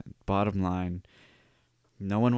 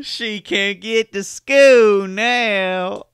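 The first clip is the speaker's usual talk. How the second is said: slow, high, laughing mock of the southern drawl; mocking the Louisiana line; 12 semitones higher, 12 dB louder, much slower than usual. Host mocks the news interviewee in a high laughing Louisiana voice. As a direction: stretch it out high through laughter, Louisiana style. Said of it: "The way he says that".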